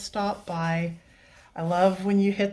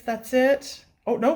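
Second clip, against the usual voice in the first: ordinary talk, nothing marked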